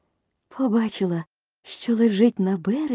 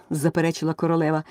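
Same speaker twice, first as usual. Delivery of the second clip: plain reading voice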